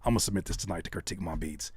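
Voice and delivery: low, soft resigned muttering; mock a half-hearted beatmaker talking to himself; imagined producer. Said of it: "And he was like".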